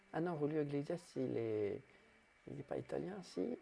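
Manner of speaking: usual speaking voice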